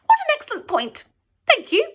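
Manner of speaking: high squeaky reply. A narrator's reading. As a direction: squeak it out nervously. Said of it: "Ivor squeaked a reply".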